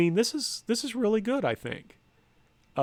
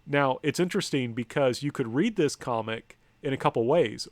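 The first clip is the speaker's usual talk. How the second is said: ordinary talk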